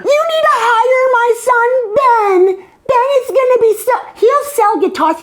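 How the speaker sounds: shrill-pitched begging like a mom pushing her son; pushy mom. Retold or imitated. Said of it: "And my mom, every single time, would go to Mark".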